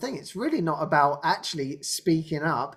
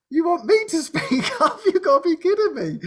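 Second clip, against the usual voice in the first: high, laughing mock disbelief; shy guy's disbelief; 10 semitones higher, 4 dB louder than usual. Shy guy asked to speak up, high laughing disbelief. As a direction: squeal it in comic, laughing protest. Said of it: "He had the shy guy".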